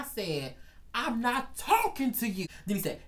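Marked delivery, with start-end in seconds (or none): 0.9-2.5 higher, emphatic acted snub; pointed retort. Worker turning on the heckling boss, emphatic acted retort. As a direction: hit it hard and high, shutting him out.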